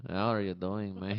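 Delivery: low mock Russian greeting; Russian accent. Comic posing as a visitor from Russia, low Russian accent. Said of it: "I am from Russia".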